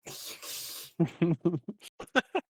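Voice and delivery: shrill rising creature screech; spider screech. Acted. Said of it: "That's a really good sound".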